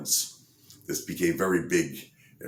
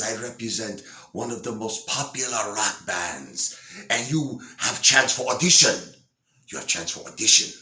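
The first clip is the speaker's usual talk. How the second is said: higher-pitched mock German accent; 4 semitones higher than usual. German record representative offering an audition, in an imitated German accent. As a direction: put on a clumsy German accent. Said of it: "I can't do a German accent".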